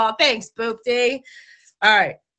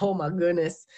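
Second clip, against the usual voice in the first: usual speaking voice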